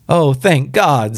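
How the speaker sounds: high, drawn-out relief; fervent relief. A narrator's reading. Old woman told her son has no pain, fervently relieved. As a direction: let the relief pour out slowly.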